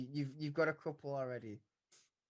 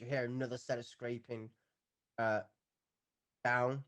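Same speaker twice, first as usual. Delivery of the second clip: usual speaking voice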